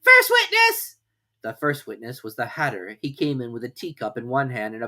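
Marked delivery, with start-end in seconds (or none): 0.0-1.0 very high, loud herald's cry; calling out. A narrator's reading. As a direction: announce the witness. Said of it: "called out".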